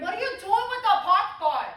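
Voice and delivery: high, strained mock yell; sudden outburst from an armchair coach; fan going off. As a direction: heckle the players in a pinched, high holler. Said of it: "I hear Bobby Lee going off".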